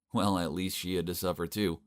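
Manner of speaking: ordinary delivery, nothing marked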